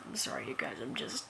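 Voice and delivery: fighting back tears in a whisper; tearful apology. Real, not acted. Crying confession to viewers.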